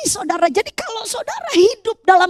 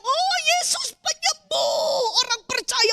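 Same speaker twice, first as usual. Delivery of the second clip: tearful, slow, high praise; weeping as he tells the church; crying; 7 semitones higher, 7 dB quieter, slower than usual. Retold or imitated. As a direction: speak through tears of joy. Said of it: "sambil nangis".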